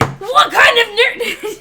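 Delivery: slow, loud mock outrage; shouting. Real, not acted. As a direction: yell it slowly in disbelief.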